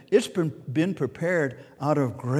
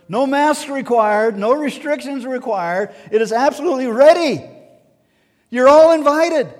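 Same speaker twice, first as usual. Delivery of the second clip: building from firm to ringing loud and high; rising preacher fervor; 7 semitones higher, 6 dB louder than usual. Real, not acted. Preacher building to a ringing call to the congregation. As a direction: swell the invitation until it rings out.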